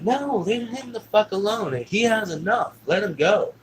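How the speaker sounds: high, drawn-out insistent protest; angry defending. Retold or imitated. Stepmother telling dad to leave child alone, angry protest. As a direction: imitate an upset woman standing up to someone.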